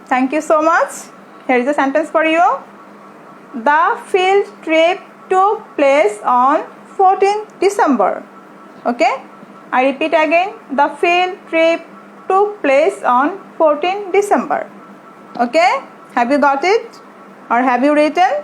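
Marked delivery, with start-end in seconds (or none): 3.7-8.1 very slow, word-by-word dictation. Shown as a demonstration. Teacher dictating for students to write, slow dictation. 10.8-14.6 very slow, word-by-word dictation. Shown as a demonstration. Teacher dictating for students to write, slow dictation.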